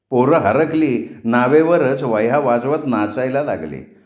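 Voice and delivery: ordinary delivery, nothing marked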